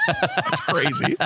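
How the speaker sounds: squealing through laughter; laughing. Real, not acted. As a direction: laugh it out high and slow. Laughing after dark joke.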